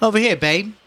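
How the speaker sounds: put-on high New Orleans accent, calling. Mrs. Riley beckoning the saleslady, New Orleans accent. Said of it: "called that accent that occurs".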